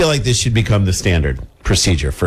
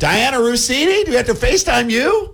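mock desperate pleading; 12 semitones higher than usual. Acts desperate driver seeking help.

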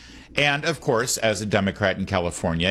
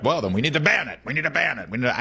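mock Democrat voice; 7 semitones higher, faster than usual. Mocking a Democrat calling for bans.

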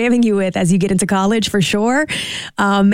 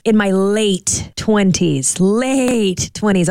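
slow, drawn-out stress on "late"; emphatic admission; much slower than usual. Real, not acted. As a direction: stretch "late" out long both times, slowly. Owning up, emphasising how late.

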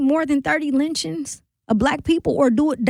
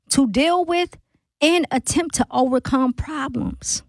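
broken down. Shown as a demonstration. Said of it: "Let's break that down".

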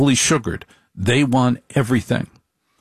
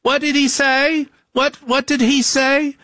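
very high, dragged-out wife voice; suspicious wife. Retold or imitated. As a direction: squeak it like a wife demanding to know. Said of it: "She goes".

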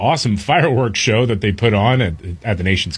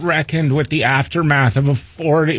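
higher mock whine; whiny liberal voice; 3 semitones higher than usual. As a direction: whine through the news copy. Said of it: "in my whiny liberal voice".

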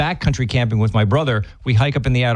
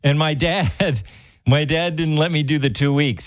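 usual speaking voice

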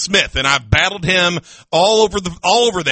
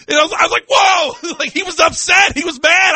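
stunned and half laughing; how can he not know; shocked; 9 semitones higher than usual. Real, not acted. Shocked at Mark's outburst.

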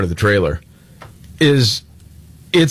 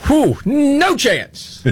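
much higher, very slow drawn-out scoff; mocking echo; much slower than usual. Host mocking a pundit's wrong prediction, drawn-out scoff. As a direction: stretch it out to mock the bad pick.

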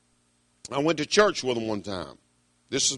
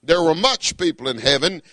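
normal talking voice

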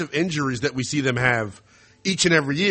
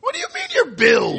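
incredulous shout. Real, not acted. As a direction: yelp it in shock. Host outraged by caller's two teams.

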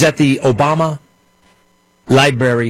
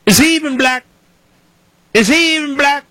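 much higher, put-on Obama impression; mock Obama voice; 14 semitones higher than usual. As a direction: mimic Obama's put-on crowd voice, pitched way up. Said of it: "I say it in that voice because Barack Obama".

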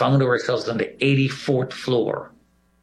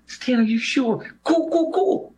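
put-on much higher, urgent young woman's voice; high-pitched mock young lady, anxious; scared young woman; 13 semitones higher than usual. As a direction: voice the frightened colleague pushing him to go. Scared young colleague urging him.

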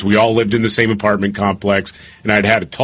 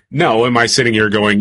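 ordinary talk

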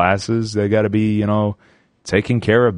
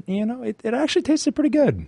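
squeaky pride; 12 semitones higher, faster than usual. Pretend. Proud of homemade pizza.

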